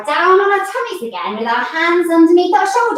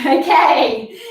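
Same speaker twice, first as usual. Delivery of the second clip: ordinary delivery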